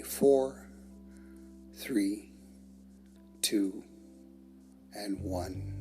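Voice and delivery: slow, spaced countdown for breathing out; measured breathing countdown. Shown as a demonstration. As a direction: count down evenly with long pauses, sinking to a soft close. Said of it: "releasing the breath the same way".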